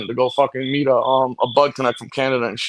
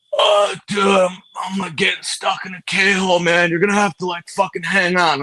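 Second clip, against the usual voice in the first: lightly voiced dazed hippie, a bit higher; mock spaced-out hippie, higher; stoned hippie in a K-hole; 4 semitones higher than usual. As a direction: drift off mid-sentence like a stoned hippie. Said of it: "it was a hippie dude".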